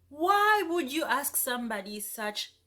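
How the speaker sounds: ordinary delivery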